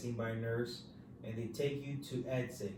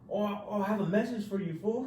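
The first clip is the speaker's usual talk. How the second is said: inmate calling; 7 semitones higher than usual. Retold or imitated.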